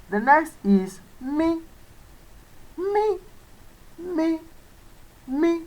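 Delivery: "mi" lifted high and held. Shown as a demonstration. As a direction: pitch "mi" way up on the high tone. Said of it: "This is the upper tone".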